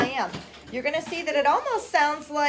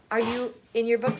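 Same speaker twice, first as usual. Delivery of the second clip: ordinary delivery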